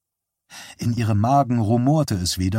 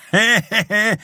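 high crowing "he, he, he"; crowing laugh; 11 semitones higher, 4 dB louder than usual. A narrator's reading. Showman after Johnny bets once more, crowing laugh. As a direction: cackle it out high like a crowing rooster. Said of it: "krähte der Schausteller".

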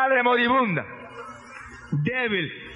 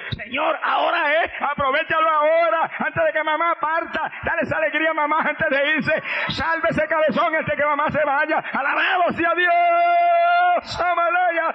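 much higher, louder pleading, ending in a shout; fervent shouting prayer; 6 semitones higher, 4 dB louder, slower than usual. Acted. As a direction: beg heaven urgently, rising to praise. Brothers pray loudly on their knees.

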